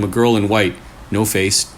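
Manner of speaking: ordinary delivery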